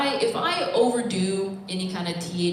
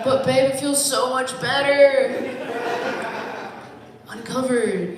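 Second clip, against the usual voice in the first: put-on shitty boyfriend; 4 semitones higher, 4 dB louder, slower than usual. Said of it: "role play being a shitty boyfriend".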